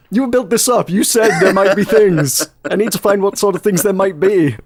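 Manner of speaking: high, fast pleading to smash pots; mock whiny insistence. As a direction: beg like a kid who must smash everything. Insisting to the dev.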